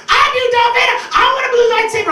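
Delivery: put-on high, loud kids begging; eager kids. Kids begging Darth Vader for a balloon, high eager shouts. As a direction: squeal it like excited children.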